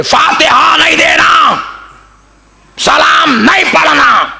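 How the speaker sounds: high, slow furious yell; angry shout. Retold or imitated. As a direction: shout the ban at the whole mosque. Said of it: "गुस्से में आके बोल दिए". (गुस्से में चीखती आवाज़)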